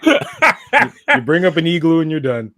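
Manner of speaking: high, loud, laughing through the words; real laughter breaking into speech. Real, not acted. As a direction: crack up while delivering a playful warning. Laughing at the Canadian teasing.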